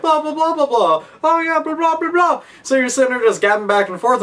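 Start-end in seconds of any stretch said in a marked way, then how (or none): 0.0-2.4 mock chatter. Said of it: "your friend's just like".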